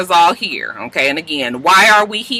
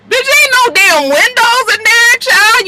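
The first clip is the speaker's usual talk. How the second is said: much higher and louder, incredulous; outraged exclaim; 5 dB louder, slower than usual. Real, not acted. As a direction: shriek it at the screen in disbelief. Reacting to dark apartment.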